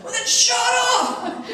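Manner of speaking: hammed-up high screech, drawn out; mad mother. Mother silencing the stranger who corrected her son, loud furious shriek. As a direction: explode in a loud mock shout. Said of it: "She was just really mad".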